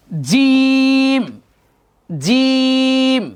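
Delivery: "জিম" with a hard j and long held "ee"; correct letter sound. Shown as a demonstration. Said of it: "জিমের উচ্চারণটাই করতে হবে".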